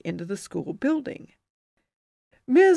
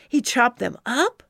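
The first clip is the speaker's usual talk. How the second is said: put-on awed kid question; 5 semitones higher, 5 dB louder than usual.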